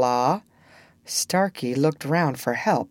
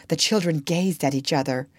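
plain reading voice

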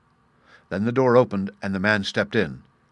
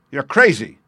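loud, higher-pitched outburst of disbelief; fierce cry; 4 semitones higher, 8 dB louder than usual. A narrator's reading. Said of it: "he cried fiercely".

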